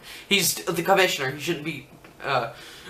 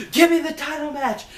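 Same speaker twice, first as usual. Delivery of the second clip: high, loud mock yell; retold wrestler screaming for her title; yelled demand; 12 semitones higher, 4 dB louder than usual. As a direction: bark the demand, acting out a furious wrestler. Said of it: "she's like".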